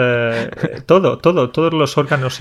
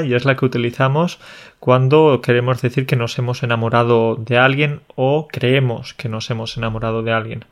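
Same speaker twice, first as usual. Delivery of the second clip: ordinary talk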